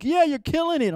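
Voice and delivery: high, loud hype-man cheer; dude cheering. Retold or imitated. Club guy hyping up the dancer, loud cheer. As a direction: cheer him on like a club bro. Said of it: "dude's like".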